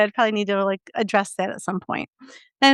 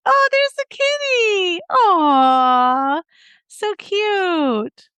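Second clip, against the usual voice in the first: put-on high, slow baby talk to a cat; cooing at a kitty; 7 semitones higher, much slower than usual. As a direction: coo like you're talking to a kitten. Dental worker cooing over a cat.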